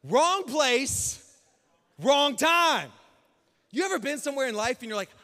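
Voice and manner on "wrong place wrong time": loud, high, punched-out phrases; emphatic proclamation. Real, not acted. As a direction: proclaim it to the whole room. Preacher proclaims his punch line.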